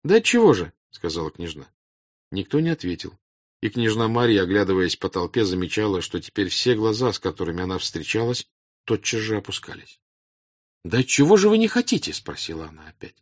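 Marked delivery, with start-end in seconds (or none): 0.0-0.7 high, loud questioning; puzzled princess. Acted. Princess questions the silent crowd, puzzled and raised. 10.9-12.1 high, loud questioning; puzzled princess. Acted. Princess questions the silent crowd, puzzled and raised.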